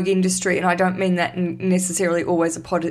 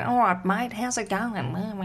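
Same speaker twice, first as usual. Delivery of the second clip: imitated broad Australian greeting; lightly put-on Western Sydney Australian; true-blue Australian accent; 5 dB quieter, slower than usual. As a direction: parrot an Aussie bloke, trailing off softly. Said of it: "I parrot it back to him".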